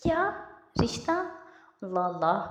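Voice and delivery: startled. A narrator's reading. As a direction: jump out of your skin with the question. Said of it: "Haifa उछल पड़ी".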